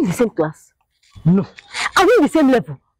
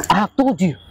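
normal talking voice

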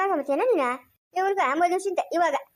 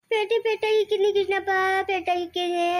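dragged-out weepy answer; tearful wailing. Acted. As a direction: whimper out the reply. Weeping woman answers questioner. (ಅಳುತ್ತಾ ಎಳೆದು ಹೇಳುವುದು)

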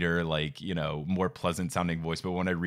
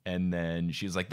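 ordinary talk, nothing marked

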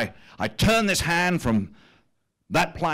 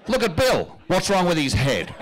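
mock campaign slogan; 5 semitones higher, 3 dB louder than usual. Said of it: "the slogan we're going to run".

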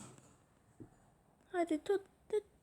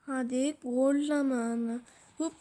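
ordinary talk

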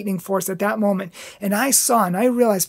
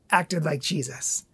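ordinary delivery

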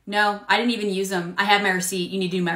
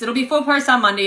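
louder, "full" stressed; firm; 5 semitones higher, 6 dB louder than usual. Acted. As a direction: lay down the rule, hitting "full". Clerk firmly repeating.

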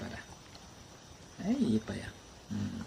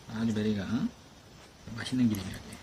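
ordinary talk

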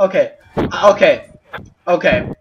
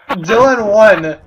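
1 laughing; 3 semitones higher, slower than usual. Real, not acted. Laughing through the punchline.

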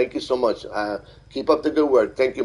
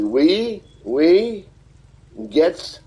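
higher and louder, an unsure try at "We, we gets der"; a learner's try at a German greeting, higher and louder; attempted German greeting; 7 semitones higher, 4 dB louder, much slower than usual. Shown as a demonstration. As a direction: sound it out carefully like a borrowed German phrase. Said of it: "try to say something like".

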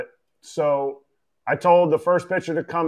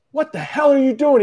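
angry manager; 8 semitones higher than usual. Retold or imitated. Manager scolding catcher behind closed door, angry. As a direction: tear into him, pitch way up. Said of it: "He's pissed".

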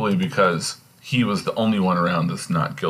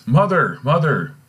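high, raised call for mother; 7 semitones higher, 5 dB louder than usual. Acted. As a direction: call out to her from outside the room. Hamlet calls out for his mother.

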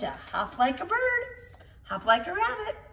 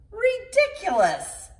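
much higher, slow duck voice; quacking duck; 11 semitones higher, 4 dB louder than usual. Acted. The duck mocks Shelley, much higher and slow. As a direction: sneer it like a smug duck. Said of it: "quacked the duck".

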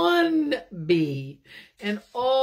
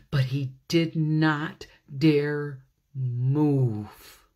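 usual speaking voice

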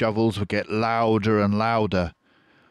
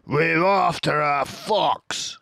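higher, slow furious cry; angry farmer shout; 10 semitones higher, 4 dB louder, slower than usual. A narrator's reading. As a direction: bellow back at the crowd. Farmer answers the crowd.

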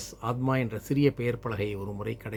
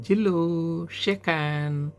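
higher drawn-out call to a pet; dog command; 6 semitones higher, much slower than usual. Acted. Boy telling his dog to do a trick, high drawn-out command. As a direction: stretch the words out in a high, coaxing command.

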